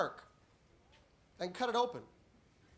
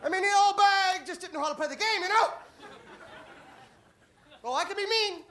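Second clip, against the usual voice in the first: builds from chuckling to loud laughing; cracking up at own joke; 10 semitones higher, 7 dB louder than usual. Acted. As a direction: laugh harder with each line. Escalating helping-old-lady gag.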